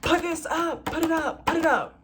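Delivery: high, loud repeated command; bossy order. Retold or imitated. Flight attendant ordering a passenger to close the tray, loud and bossy. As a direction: snap the order at the passenger like a sergeant. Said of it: "She comes by and she's like".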